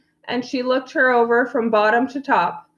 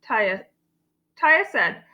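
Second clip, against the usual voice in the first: ordinary delivery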